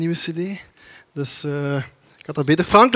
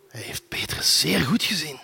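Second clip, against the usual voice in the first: whisper; 3 semitones lower, 6 dB quieter than usual. A narrator's reading. Confiding aside to congregation.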